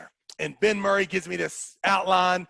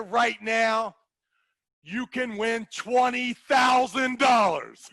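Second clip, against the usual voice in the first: higher, shouted carnival barker pitch with slow, drawn-out hooks; hyped announcer; 4 semitones higher, slower than usual. Retold or imitated. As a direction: sell the giveaway to a crowd like a fairground MC. Retold big cash giveaway pitch.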